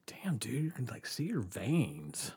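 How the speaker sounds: whispered, slow and hushed. Retold or imitated. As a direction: breathe it out like a worried aside. Someone remarks on his thin body.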